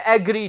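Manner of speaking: "एग्री" opening on a full "ae"; wrong pronunciation. Shown as a demonstration. Teacher quoting what many people say, mispronounced. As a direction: say "एग्री" as AE-gree. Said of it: "बहुत से लोग इसे कह देते हैं".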